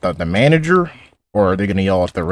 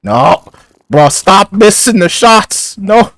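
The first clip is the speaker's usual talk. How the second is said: high, very loud, drawn-out shout; exasperated yell; 14 semitones higher, 11 dB louder, much slower than usual. Real, not acted. Player to teammate missing shots in a game, exasperated shouting. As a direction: yell in real frustration at a botched play.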